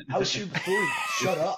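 snapping at a friend through laughter; mock annoyed. Retelling snapping at a partygoer, mock annoyed shout.

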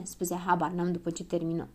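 plain reading voice

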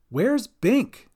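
high, worried call. A narrator's reading. Cat looks for Bink from the boat, calling. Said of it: "called Cat".

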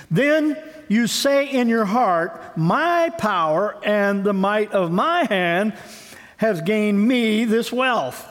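slow scripture reading. Acted.

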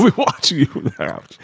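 chuckling through it; laughing repeat. Real, not acted. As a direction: laugh while echoing the line. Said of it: "laughing repeat".

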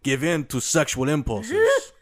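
a hint of an Italian accent; lightly voiced Italian sculptor. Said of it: "That's my Italian accent".

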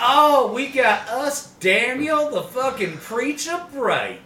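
high, slow, drawn-out preacher introduction; hammed-up church call; mock preacher. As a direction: announce him like a revival preacher. Plays a church preacher introducing Daniel.

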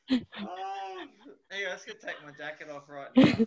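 high, very loud, words through laughter; laughing hard. Real, not acted. Host cracking up at a guest's story, laughing hard. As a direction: struggle to speak while cracking up.

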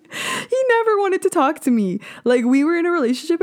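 squeaky-high incredulous complaint; high-pitched disbelief. Real, not acted. Woman telling listeners her ex never talked to her, high incredulous.

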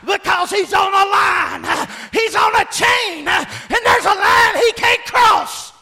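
rising, shouted preacher climax; full-force preaching. Real, not acted. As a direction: drive it home to the congregation.

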